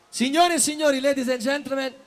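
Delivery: big high showman announcement, drawn out; announcer shout. Real, not acted. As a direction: call the name to the crowd like an MC. Introducing the guest on stage. (annuncio da presentatore gridato)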